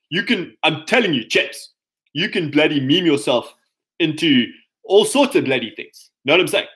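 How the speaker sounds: meme yourself into a British bloke; put-on British accent. Coach to chaps, showing off a mock British accent. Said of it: "this is more natural for me".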